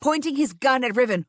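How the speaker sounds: usual speaking voice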